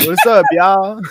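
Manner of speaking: loud, playful Southern greeting; attempted Southern accent. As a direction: try out a wobbly Southern drawl. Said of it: "I don't know where this accent is coming from".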